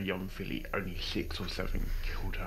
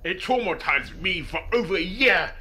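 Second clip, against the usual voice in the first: emotional outburst; 9 semitones higher, 8 dB louder than usual. Acted. Detective recalls a filly's suicide.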